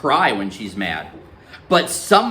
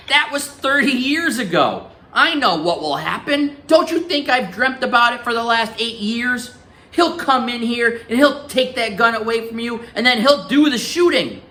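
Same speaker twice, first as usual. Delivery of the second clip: anxious grandmother; 10 semitones higher than usual. Acted. Grandmother's fearful argument.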